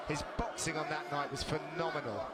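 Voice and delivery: ordinary talk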